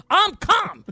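high-pitched protest of calm; hammed-up high outburst; retold yelling. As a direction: imitate yelling while insisting on calm. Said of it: "stop yelling".

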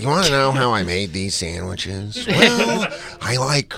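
creepy put-on Joker voice with whispered breaks. Co-host mocking a friend as the Joker, creepy with a whisper. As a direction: lightly play a creepy Joker, whispering one word. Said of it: "just like the Joker".

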